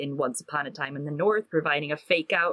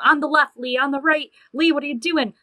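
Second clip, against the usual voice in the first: higher, louder imitation of a bossy sidekick; urgent warning; 8 semitones higher, 4 dB louder than usual. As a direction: call out directions to a brawling partner. Imitates Hester warning Lee in fights.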